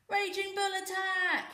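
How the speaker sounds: high, loud, slow war roar. A narrator's reading. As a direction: bellow the charge like a bull. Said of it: "he roared".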